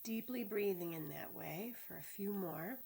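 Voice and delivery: unhurried body-scan guidance with long pauses; measured, spacious meditation voice; guided meditation. Real, not acted. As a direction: lead them calmly through the body, phrase by phrase.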